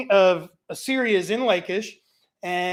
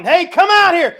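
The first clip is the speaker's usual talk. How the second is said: yelling; 9 semitones higher, 12 dB louder than usual. Retold or imitated. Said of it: "they're yelling".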